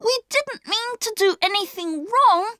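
put-on high-pitched boy pleading; upset, high-voiced excuse; dismayed apology. A narrator's reading. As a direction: protest innocence in a high, worried child's voice. Boy apologises after the outburst.

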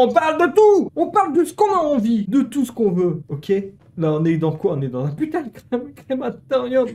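high loud exasperated ranting; heated insisting. Real, not acted. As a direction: insist loudly, half laughing. (insistance exaltée)

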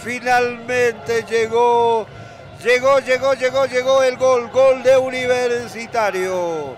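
loud, high, ecstatic celebration; excited shouting. Real, not acted. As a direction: shout the joy of the goal. Goal celebration.

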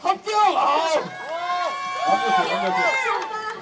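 high, loud, drawn-out praise; excited cheering. Real, not acted. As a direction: cheer it like a judge. Host cheering a perfect impression.

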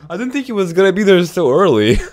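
high, loud laughing disbelief; laughing after a scare. Real, not acted. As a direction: protest through shaken laughter.

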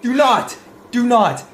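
high protest building to a shout; alarmed warning. Real, not acted. Player begging the character not to reach in, alarmed warning. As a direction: warn urgently, rising into a cry.

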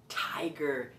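hushed whisper; very soft, voiceless reveal. Shown as a demonstration. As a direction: whisper the animal's name like a secret.

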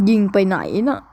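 ordinary talk, nothing marked

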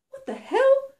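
high, loud startled exclamation; shocked outburst. A narrator's reading. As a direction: blurt it out, reeling from a blow. Heroine reels from an energy blast.

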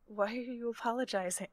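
soft, careful question; hushed and tender; gentle concerned character. Acted. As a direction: ask softly, with concern. Player voices her character.

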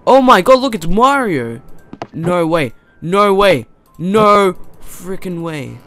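loud, high cries of disbelief; shocked outburst. Real, not acted. Gamer suddenly spots Mario on screen, shocked outburst. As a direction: blurt it out, then stretch the last refusal.

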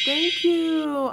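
high sing-out thank-you; real, high-pitched gratitude; gushing thanks. Real, not acted. Thanking the top gifter by name.

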